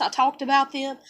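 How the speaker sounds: ordinary delivery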